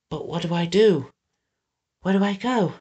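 close to tears. A narrator's reading. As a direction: wonder helplessly, holding back tears. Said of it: "Eggs wondered, biting his lips to stop himself from crying".

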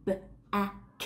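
ordinary talk, nothing marked

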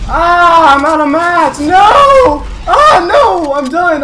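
loud, high despairing shouts; frustrated yelling. Real, not acted. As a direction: yell in frustration as you lose. Losing fight, out of materials.

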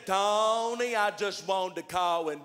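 very slow, drawn-out caller; slow-talking John on the phone; John's slow talk. Retold or imitated. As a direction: stretch every word like a slow talker. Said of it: "John talks like that".

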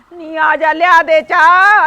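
loud, slow call across the house; drawn-out call. Acted. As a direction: stretch it out, shouting for the girl. Mother-in-law calling daughter for tea.

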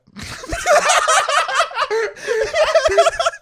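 very high, loud shrieking laugh; loud laughing outburst. Real, not acted. Friends cracking up over the stress story, shrieking laughter. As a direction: burst out in a big laugh.

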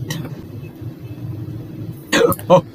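sharp gasp. Real, not acted. Jumpscare in horror game.

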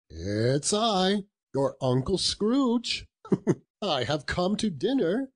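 high, drawn-out uncle announcing himself; reformed Scrooge, eager. A narrator's reading. Uncle arriving for dinner at his nephew's, eager. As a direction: announce yourself in a high, pleased voice.

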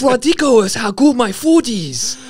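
high, loud cartoon Chinese accent; mock Chinese accent. Imitating South Park creators recording, loud mock Chinese accent. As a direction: gush in a hammed-up Chinese accent.

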